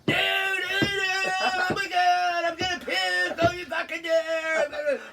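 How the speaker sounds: high, loud drawn-out panicked wail; losing it. Retold or imitated. Storyteller imitating the man squeamish about the eye, losing it. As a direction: imitate a tantrum, squealing in disgust. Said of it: "he'd fucking lose it ... pull a tantrum almost".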